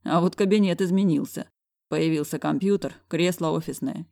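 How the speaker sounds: usual speaking voice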